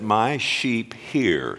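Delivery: slow, deliberate scripture line; measured call to recite; slow recitation. Shown as a demonstration. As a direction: say it slowly for the church to repeat. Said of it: "Say that with me".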